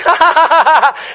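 a quick hammed-up laugh; imitating revival laughter. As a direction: burst into a loud laugh like the crowd. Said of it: "people are laughing".

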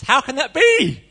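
put-on young Mary laughing in disbelief at the angel; incredulous Mary. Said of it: "that's the first question she asked".

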